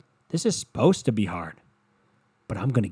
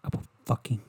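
quiet, menacing mock threat; a hint of a seething partner; 3 dB quieter than usual. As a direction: threaten under your breath. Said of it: "you go".

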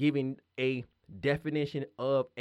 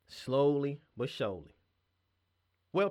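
ordinary talk

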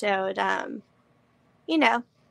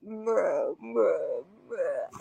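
slow-motion voice, lower and dragged; slowed down deep; 3 semitones lower, 4 dB quieter, much slower than usual. Retold or imitated. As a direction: drag each word like slowed-down audio. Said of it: "it sounds like you're in slow motion".

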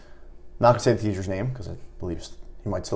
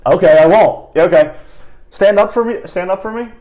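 louder, higher, mock-agreeable challenge; teacher cheerfully calling a student up to the front; teacher taking the dare; 7 semitones higher, 7 dB louder than usual. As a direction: call the kid's bluff. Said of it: "I remember someone going".

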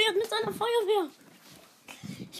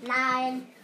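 usual speaking voice

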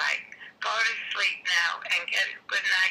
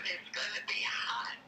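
hushed warning whisper; 6 dB quieter than usual. Real, not acted. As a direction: whisper it close to the phone.

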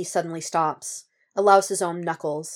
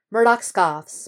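ordinary delivery

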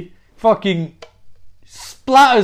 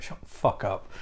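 whispered, lower brush-off; hushed snap; 7 semitones lower, 12 dB quieter than usual. Retold or imitated. Eve silencing Bob with a whispered snap. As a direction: cut him off under your breath.

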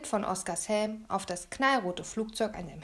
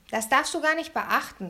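raised-pitch, pressing reassurance; urging; 5 semitones higher, 4 dB louder than usual. A narrator's reading. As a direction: coax your friend to let it go. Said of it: "drängelte ich".

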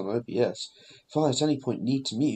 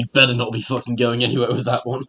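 a loud, playful threat, punching the word better; mock threatening shout; 4 dB louder than usual. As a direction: bark it as a joking warning. Mock threat over the bottle.

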